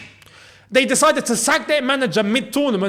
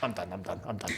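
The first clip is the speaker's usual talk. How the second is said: deflated low mutter, much softer; mock giving up; 12 semitones lower, 15 dB quieter, faster than usual. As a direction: sink into defeat, voice dropping away. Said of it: "I thought".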